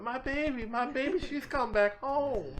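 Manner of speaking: put-on high, loud, tearful joy as she runs to her child; joyful cry. Mother running to her returned daughter, high joyful cry. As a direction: cry out with overjoyed relief. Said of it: "you can kinda hear her going".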